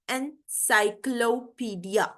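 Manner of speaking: encyclopedia in slow chunks, en, cyclo, pedia; split into syllables. Shown as a demonstration. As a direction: break encyclopedia into its parts with small gaps. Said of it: "If a word has more than one sound".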